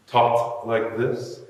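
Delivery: slow emphatic refrain; slow, drawn-out refrain. Shown as a demonstration. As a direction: stretch out "talked like this" slowly with a rise.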